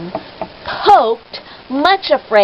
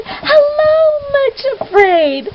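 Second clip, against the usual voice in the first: high, bright-voiced cheerful hello; shepherd cheerfully greeting a scared girl; cheery greeting; 5 dB louder, slower than usual. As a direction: greet her like a happy friend. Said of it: "sound of his cheery voice".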